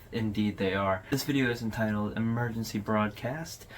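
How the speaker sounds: ordinary talk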